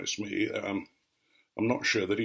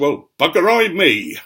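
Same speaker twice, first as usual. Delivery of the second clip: louder, higher rural dialect exclamation; lorry driver's exclamation; 7 semitones higher, 9 dB louder than usual. Retold or imitated. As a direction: burst out like the surprised country lorry driver. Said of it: "and he said".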